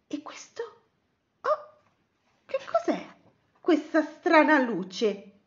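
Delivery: high, halting questions; puzzled wonder. Acted. Betty and Cosmo spot a strange light, puzzled wonder. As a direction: wonder aloud, slowing toward the end.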